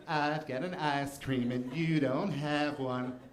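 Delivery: lightly voiced gloating kid, slow and laughing; a hint of a bragging Eddie Murphy kid. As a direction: tease them with your ice cream, half-laughing. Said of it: "Eddie Murphy bit".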